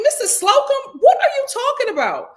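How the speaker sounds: high, loud hammed-up British accent, mocking; mock posh British accent. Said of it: "'Cause I feel like this accent".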